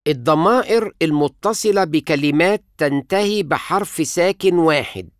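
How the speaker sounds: normal talking voice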